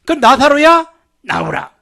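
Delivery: loud call to Lazarus; preacher booming Jesus' command. Acted. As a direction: call him out of the tomb. Preacher voices Jesus calling Lazarus.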